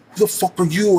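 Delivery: mock a stranger squaring up to you; high-pitched retold challenge; confrontational challenge. Acted roadside confrontation, imitating the man.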